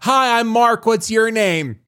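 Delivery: high, eager voice of a confused patient; Mark's eager greeting. Retold or imitated. Patient greeting a stranger in the parking lot, high and eager. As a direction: pitch it up like an overfriendly stranger. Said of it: "sticks out his hand and goes".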